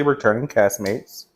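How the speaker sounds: ordinary talk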